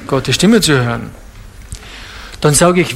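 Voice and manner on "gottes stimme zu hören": lower, lightly voiced lukewarm believer; a hint of an indifferent churchgoer shrugging it off; mild mock of a lukewarm answer. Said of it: "Dann sagen sie".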